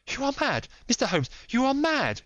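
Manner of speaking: high, loud desperate cry; cried out. Acted. Lady to Holmes, cried out high and loud. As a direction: fling the accusation at him. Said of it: "she cried at last".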